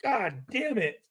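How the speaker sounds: gasp, then mutter the curse; mock shock. Rookie reacting to Plunkett in the shower, startled then muttering. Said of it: "I'm like".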